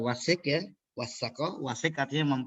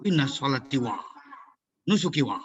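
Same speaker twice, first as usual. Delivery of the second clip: "wa" stuck to the end of each piece with a pause after it; choppy recitation ending each phrase in "wa"; wrong phrasing demo. Shown as a demonstration. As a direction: break the verse wrongly on each "wa". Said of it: "Kalau di belakang bacanya gitu".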